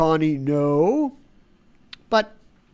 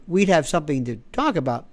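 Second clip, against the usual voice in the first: usual speaking voice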